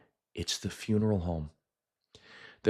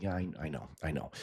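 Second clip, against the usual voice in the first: soft resigned agreement; 3 semitones lower, 10 dB quieter, faster than usual. Retold or imitated. Quiet resigned reply to aunt.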